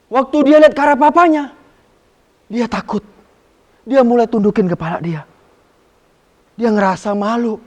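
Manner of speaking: put-on lively, loud storytelling for kids; storytelling narration. A narrator's reading. Sunday school teacher telling children a story, animated and loud. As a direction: tell the story with big rising drama.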